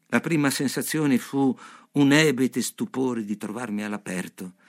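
plain reading voice

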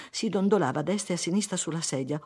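ordinary delivery

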